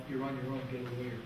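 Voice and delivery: ordinary talk, nothing marked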